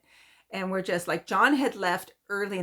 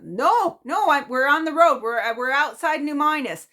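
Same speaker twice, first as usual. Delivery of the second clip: John on the phone; 7 semitones higher, 7 dB louder than usual. Retold or imitated. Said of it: "and John's like".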